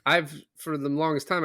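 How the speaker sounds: ordinary delivery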